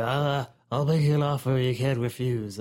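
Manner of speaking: Godfather impression. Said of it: "Like the".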